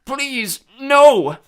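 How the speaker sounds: high, loud desperate pleading; pitiful moan. A narrator's reading. Beaten Cyclops begging Percy for his life. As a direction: beg for mercy, pitifully. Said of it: "the Cyclops moaned".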